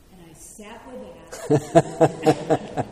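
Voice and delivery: drawing it out as laughter spills over; laughing. Real, not acted. Student retelling her ordeal to the teacher, laughing it out. Exasperated refrain breaks into laughter.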